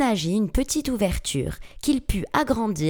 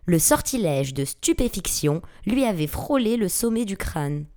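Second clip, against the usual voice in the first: usual speaking voice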